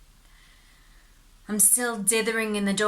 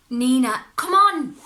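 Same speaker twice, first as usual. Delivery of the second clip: high, loud pleading protest; exasperated protest; 5 semitones higher, 9 dB louder than usual. Acted. Man refusing Nina's request, loud exasperated plea. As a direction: plead with her to drop it.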